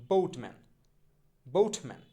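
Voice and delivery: বোটম্যান with a gliding "bout" vowel, loud first beat; corrected pronunciation. Shown as a demonstration. Teacher drilling boatman with learners, বোটম্যান with "ou" glide. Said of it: "এই তিনটা আমরা কী দেখলাম".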